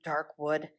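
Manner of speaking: usual speaking voice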